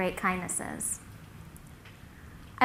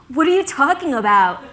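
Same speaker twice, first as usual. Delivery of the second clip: louder, puzzled comeback; baffled; 4 semitones higher, 6 dB louder than usual. Retold or imitated. Logan questioning his buddy's warning, baffled. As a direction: fire back in confusion.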